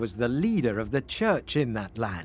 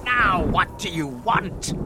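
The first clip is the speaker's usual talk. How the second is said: slow, exasperated, raised voice; angry fish; 5 semitones higher, slower than usual. A narrator's reading. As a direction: demand it crossly, stretching the words. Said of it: "shouted the magic fish angrily".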